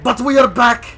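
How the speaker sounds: slow, loud hyped announcement; hype shout. Real, not acted. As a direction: belt it out like a hype man. Hyped intro of the killer.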